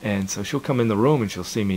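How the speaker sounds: ordinary talk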